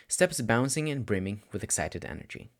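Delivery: plain reading voice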